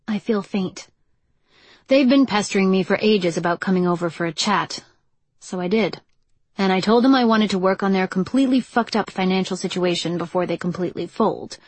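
ordinary delivery, nothing marked

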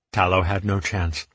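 ordinary delivery

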